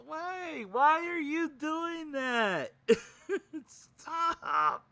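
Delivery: high, drawn-out wailing question; hammed-up high-pitched protest; mock despair. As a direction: plead it slowly in comic anguish. Acting out exasperated viewer pleading.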